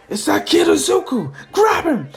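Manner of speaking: high, loud shouted order; villain shout. Acted. Villains spotting Izuku and yelling to grab him, loud. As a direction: yell at your henchmen to grab him.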